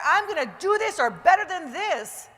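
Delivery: energetic loud affirmation; bold declaration. Shown as a demonstration. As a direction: declare it with full conviction. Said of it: "that freedom to declare".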